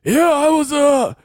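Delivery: high, loud, throat-straining cowboy voice; John Marston impression. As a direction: push a rough outlaw voice till it hurts. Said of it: "that's how that guy talks".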